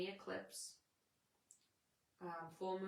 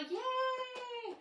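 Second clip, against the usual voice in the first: much higher, loud squeal of joy; excited cheer; 7 dB louder than usual. Real, not acted. Cheering Rochelle's exciting news with a loud squeal. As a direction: squeal it happily.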